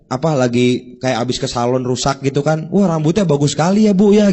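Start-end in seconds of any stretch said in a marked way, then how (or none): 2.7-4.3 higher, louder gushing compliment; mock-sweet praise of a ruined haircut; fake flattery. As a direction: overdo the praise until it stings. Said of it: "kayak menghina".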